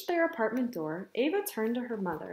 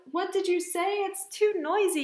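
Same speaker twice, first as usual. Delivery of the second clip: high-pitched calling over noise; half-yell it, pitched up; mild mock yell; 8 semitones higher than usual. Mother calls over the noisy park, mildly yelling. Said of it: "yelled Mother".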